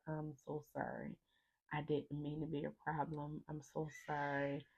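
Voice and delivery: very soft, subdued apologising; remorseful apology. Retold or imitated. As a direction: quietly plead not to be a burden. Retold apology in surgery, near tears.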